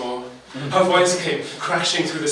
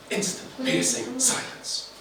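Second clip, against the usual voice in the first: usual speaking voice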